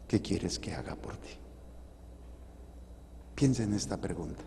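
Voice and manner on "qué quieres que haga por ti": whispered, gentle and intimate; Jesus quietly asking the beggar; hushed question. Acted. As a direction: ask it softly, like a secret.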